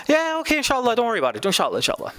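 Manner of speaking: high, breezy brush-off that drops at the end; mock a casual, noncommittal yes, starting high; fake insha'Allah. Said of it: "like, the fake insha'Allah".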